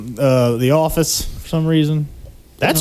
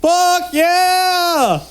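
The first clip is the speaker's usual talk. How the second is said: excited cheer; 12 semitones higher than usual. Real, not acted. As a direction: shout it like great news. Excited cheer about snow.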